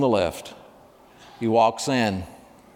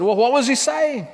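much higher, urgent question; alarmed mother; 12 semitones higher than usual. Acted. Anxious mother presses the nurse, alarmed and much higher. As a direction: blurt it out in alarm.

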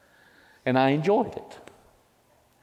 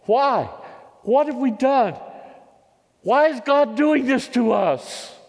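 retold crying out; 12 semitones higher than usual. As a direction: cry it out to your wife in despair. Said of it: "I broke down crying".

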